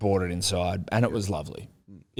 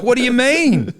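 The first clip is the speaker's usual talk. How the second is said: much higher, louder, incredulous; stunned disbelief; 8 dB louder than usual. Retold or imitated. Son hearing Dad changed the baby's pajamas, incredulous. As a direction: react as if you can't believe it.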